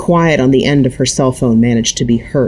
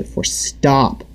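plain reading voice

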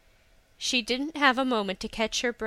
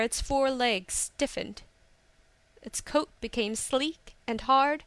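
ordinary delivery

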